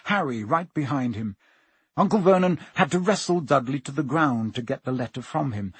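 plain reading voice

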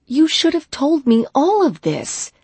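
loud, high, upset; angry outburst. A narrator's reading. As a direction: let your anger spill out. Angry at being kept out.

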